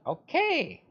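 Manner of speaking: a high, clipped mock "okay"; curt okay. Teen retelling her reply to the friend, a mock curt okay. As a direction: brush her off with one sharp word.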